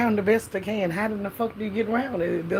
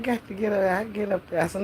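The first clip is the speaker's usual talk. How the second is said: normal talking voice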